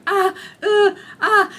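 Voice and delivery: high, loud, strained little cries of pain; pained whimpers. Retold or imitated. Patient in pain during catheter, high strained whimpering cries. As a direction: yelp through the pain, swallowing the swear words. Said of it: "And outside I'm just going".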